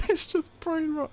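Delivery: high-pitched, helpless laughing voice; cracking up. Real, not acted. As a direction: squeeze the words out through laughter. Repeats it while cracking up.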